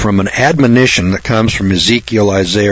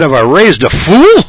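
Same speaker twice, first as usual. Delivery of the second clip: louder, higher furious father; mock enraged dad; angry dad; 7 semitones higher, 5 dB louder than usual. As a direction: bark it as an outraged father. Angry father after beating.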